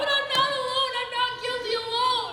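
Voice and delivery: high, loud half-sung wail; unhinged; hysterical wail. Acted. Fran hysterical.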